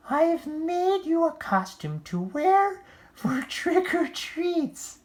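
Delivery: very high, loud, gushing mom voice; doting mother. Acted. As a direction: coo it like a proud mother. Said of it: "said Oscar's mom".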